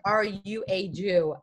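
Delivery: lightly voiced mimic of the date's blunt question; imitating his blunt question. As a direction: drag it out flatly like he did. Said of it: "And the way he said".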